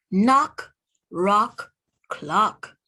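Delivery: usual speaking voice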